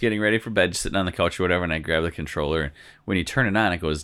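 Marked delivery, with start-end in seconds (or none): none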